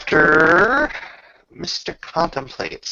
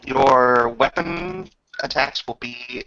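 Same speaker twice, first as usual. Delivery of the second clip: ordinary delivery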